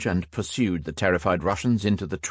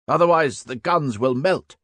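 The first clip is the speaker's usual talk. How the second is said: shouted order; 7 semitones higher, 3 dB louder than usual. A narrator's reading. Veteran to Hals over the guns, shouting. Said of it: "the veteran shouted to Hals".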